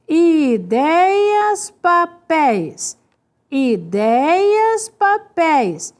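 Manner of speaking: "Ideias" and "papéis" slowly stretched; drawn-out syllables. Shown as a demonstration. Teacher having students compare two words, syllables slowly stretched.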